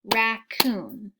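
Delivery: Raccoon said slowly as rac-coon, two clapped beats; clapped syllables. Shown as a demonstration. Teacher to class, clapping Raccoon out in two beats. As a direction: split Raccoon into two even claps. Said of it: "Do it one more time".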